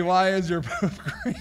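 words dissolving into real laughter; helpless laughter. Real, not acted. Host answering a silly search suggestion, helplessly laughing. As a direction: crack up halfway through the question. This just kills me.

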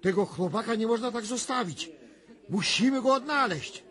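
agitated trance vision. Acted. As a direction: speak as if seeing it, growing alarmed.

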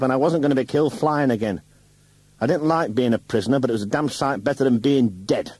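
loud, high, heated rant; husband furiously defending himself to wife, loud; angry defence. Acted. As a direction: defend yourself hotly and loudly.

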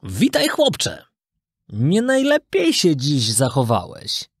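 slow, measured, gently scolding; wise talking cricket. Acted. Talking cricket greets the runaway puppet, slow and reproachful. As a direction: greet him slowly, then reproach. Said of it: "powiedział".

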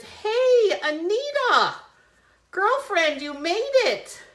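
high, loud delighted hello; light up as your friend arrives; excited greeting. Real, not acted. Host happily greeting a friend who joined the livestream.

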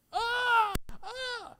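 high, drawn-out cry; fading high wail; scream of torment. Acted. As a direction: act out agony in the flames. Acts the torment of the damned.

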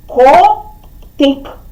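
КОТИК split into ко and тик, pause between; two separate, measured syllables in Котик. Shown as a demonstration. Teacher dividing the riddle answer for children, КОТИК syllable by syllable. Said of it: "слово котик поділимо на склади".

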